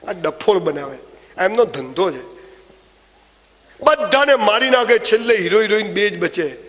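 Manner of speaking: usual speaking voice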